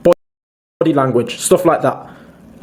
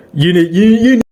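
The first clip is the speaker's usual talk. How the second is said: oversell it like a pushy salesman; over enthusiastic salesman pitch; 3 semitones higher, faster than usual. Shown as a demonstration. Asking a girl on a date, hammed-up pushy sales pitch. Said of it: "the over enthusiastic salesman pitch".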